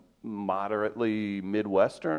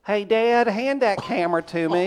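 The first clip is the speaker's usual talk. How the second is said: put-on Southern accent; 9 semitones higher than usual. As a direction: give a taste of a thick Southern twang. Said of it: "Give me a little taste".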